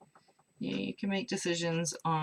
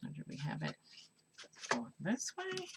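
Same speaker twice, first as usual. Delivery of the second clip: soft, absent-minded self-talk; under the breath, thinking aloud; mumbling to oneself; 4 semitones higher, 6 dB quieter than usual. Real, not acted. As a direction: mumble it quietly while puzzling it out. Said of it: "as I mumble to myself".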